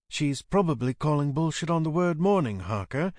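a light character voice for a dry interjection; lightly voiced woman character. Glory cutting in on Harker and Bex, lightly voiced character.

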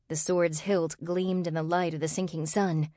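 ordinary delivery, nothing marked

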